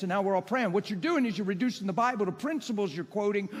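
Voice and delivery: ordinary talk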